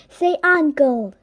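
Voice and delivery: shouting. Acted. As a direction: shout it to force a surrender. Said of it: "shouted Super Librarian".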